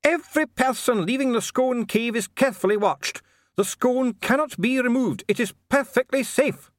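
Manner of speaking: shrieked insistence, very high-pitched; shouting. A narrator's reading. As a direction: shout it as a dwarf official losing his composure. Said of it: "Dee was shouting now".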